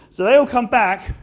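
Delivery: ordinary delivery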